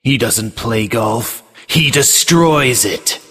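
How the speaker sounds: slow, dramatic trailer read; movie trailer voice. Acted. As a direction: announce it like a film trailer. Reads tagline as movie trailer.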